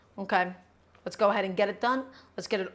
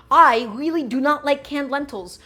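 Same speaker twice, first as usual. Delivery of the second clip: louder and slower, leaning hard on every word; emphatic declaration; 4 semitones higher, 7 dB louder than usual. Real, not acted. To stream viewers, declaring firmly that canned lentils are out. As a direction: plant your flag on it, slow and firm.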